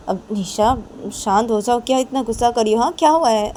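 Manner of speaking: rising from steady to high and loud; alarmed questioning. Acted. Friend asks fuming Nisha what is wrong, alarmed. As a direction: try to calm her, then demand to know what's wrong.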